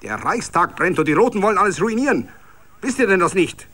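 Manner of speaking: high, loud thundering accusation. A narrator's reading. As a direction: thunder it down on them. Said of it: "donnerte noch lauter".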